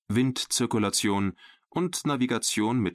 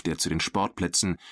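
ordinary delivery

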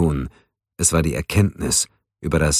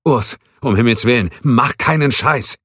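alarmed; 6 semitones higher, 4 dB louder than usual. A narrator's reading. Keller sees gun pointed at him.

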